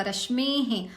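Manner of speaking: रश्मेः ending in a light "he" sound; visarga as he. Shown as a demonstration. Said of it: "तो हे इस तरह से होगा".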